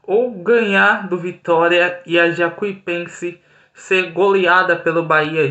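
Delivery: ordinary delivery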